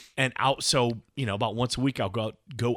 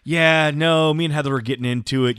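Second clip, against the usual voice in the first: loud, fast imitation of a friend; mock worked-up excuse; 4 semitones higher, 8 dB louder than usual. Friend excusing himself over a fight, loud and worked up. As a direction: blurt the excuse at full volume.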